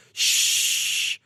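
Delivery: long hissed shush. Acted. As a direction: draw out a hushing "shh". Acting out hushing a talker.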